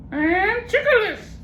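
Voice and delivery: very high slow parrot garbling a long word. Acted.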